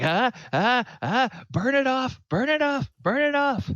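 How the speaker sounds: high, loud panicked yelling; hammed-up frantic cries for help; acted panic. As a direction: yelp it over and over like someone in agony. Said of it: "And he's like".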